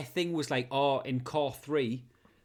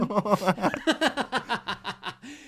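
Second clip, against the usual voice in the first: long higher laugh; 6 semitones higher, 4 dB louder than usual. Real, not acted. Cracking up at his own pensioner joke, long laugh.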